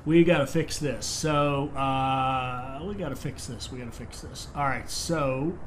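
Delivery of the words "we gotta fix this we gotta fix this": muttering to himself. Real, not acted. Repeats to himself.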